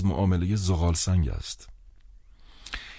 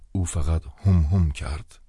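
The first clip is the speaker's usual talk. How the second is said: ordinary talk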